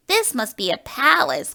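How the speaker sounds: a toy bear marvelling at the store; amazed gasp. Acted. A little bear gasping at a palace, awed. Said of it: "Corduroy gasped".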